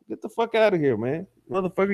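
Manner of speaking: usual speaking voice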